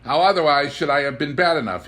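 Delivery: put-on the boy beaming at his own cleverness; radiant assent. A narrator's reading. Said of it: "afford radiantly to assent".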